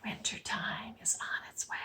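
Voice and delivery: breathy whisper of the leaves. Acted. Teacher whispers what the leaves say. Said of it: "you'll hear them say".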